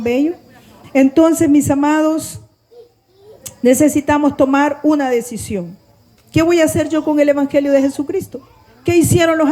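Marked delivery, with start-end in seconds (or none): none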